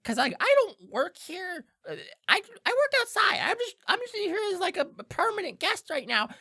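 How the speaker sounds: anxious, stammering little creature voice; Diplin kinda whispering. Acted. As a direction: plead urgently in a strained, high stage whisper. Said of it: "you hear Diplin kinda whispering".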